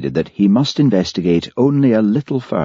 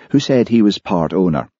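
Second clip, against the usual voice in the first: usual speaking voice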